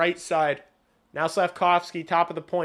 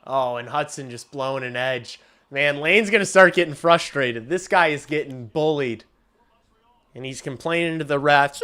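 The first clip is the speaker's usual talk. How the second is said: ordinary delivery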